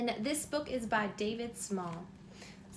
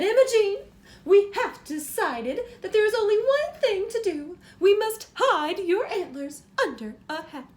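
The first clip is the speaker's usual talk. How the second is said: higher, louder, grand announcing mother; dramatic mother; 7 semitones higher, 10 dB louder than usual. Acted. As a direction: declare the family's decision with great drama.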